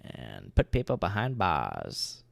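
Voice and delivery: slow, dramatic noir detective. Pretend. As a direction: drag it out like a hardboiled detective. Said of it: "with your detective voice".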